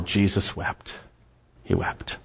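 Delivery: soft, hushed and low. Real, not acted. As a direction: let it drop to a near whisper. Solemn on shortest verse.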